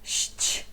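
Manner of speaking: ść as a soft unvoiced hiss; whispered consonant cluster. Shown as a demonstration. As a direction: breathe out ść with no voice. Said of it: "esta aqui é liść".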